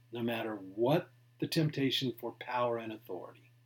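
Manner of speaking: ordinary delivery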